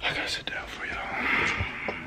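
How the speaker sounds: quiet whispered aside. Real, not acted. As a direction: whisper it, breathy and slow.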